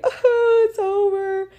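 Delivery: high, wailing fake cry; mock sob. Retelling crying at the Vampire Diaries finale, mock wailing. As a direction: ham up the heartbreak of the show ending. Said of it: "So anyways, I was sobbing".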